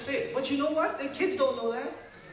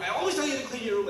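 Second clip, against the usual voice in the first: fast, louder mock nagging parent; nagging yell; 7 semitones higher, 5 dB louder, faster than usual. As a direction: snap at the kids about their room. Parent yelling at kids.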